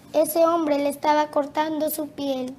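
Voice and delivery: normal talking voice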